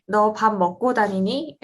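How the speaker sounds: quick Seoul Korean with a rising tone; Seoul accent. Shown as a demonstration. Demonstrating the Seoul Korean meme for friends, in a quick rising Seoul accent. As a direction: rattle off "너 밥 먹고 다니니?" in Seoul Korean, tone lifting at the end. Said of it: "the way you raise your tone is very Seoul accent".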